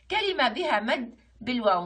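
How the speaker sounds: ordinary talk, nothing marked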